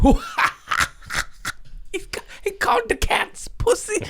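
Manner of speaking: high, giggling squeal over the joke; laughing. Retold or imitated. Laughing at his own dad joke, high giggling squeal. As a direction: cackle through the words. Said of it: "I'm like".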